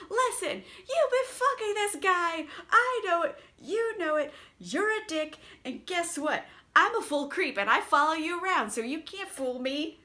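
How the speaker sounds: high, hammed-up accusing boyfriend; mock jealous rant. Raoul mock-accusing Christine at the masquerade, high whiny rant. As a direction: whine it like a jealous creep. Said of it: "he's just like".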